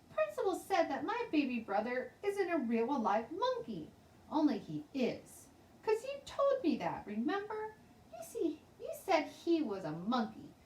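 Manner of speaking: put-on high, eager little girl insisting; a young girl sure she is right.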